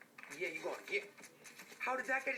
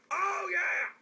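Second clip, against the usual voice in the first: triumphant shout; 12 semitones higher, 7 dB louder than usual. Real, not acted. Big triumphant yell.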